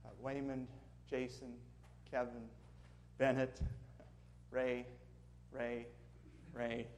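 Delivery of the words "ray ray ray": ordinary delivery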